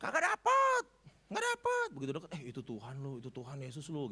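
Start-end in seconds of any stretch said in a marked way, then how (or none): 0.0-1.9 high, loud shout from the boat; shouting back. Retold or imitated. The fishermen shout back to the shore, loudly. 2.3-4.1 hushed aside. Retold or imitated. One disciple quietly tells Peter it's Jesus.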